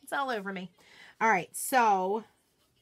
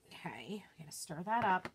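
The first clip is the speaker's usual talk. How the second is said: quick whisper to herself; whispered aside; 3 semitones lower, 8 dB quieter than usual. Real, not acted. Crafter stirring chalk paste, murmured whisper. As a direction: whisper it under the breath.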